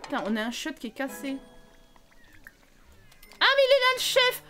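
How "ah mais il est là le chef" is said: high, loud thrilled exclamation; gleeful hail; delighted greeting. Real, not acted. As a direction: welcome the chef with glee. Greeting the chef Sim.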